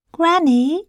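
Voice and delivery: put-on high, light child's voice; little girl voice. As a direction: ask like a young girl. Child speaks to wolf in bed.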